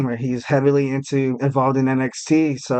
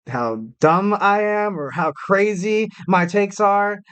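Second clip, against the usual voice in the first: ordinary talk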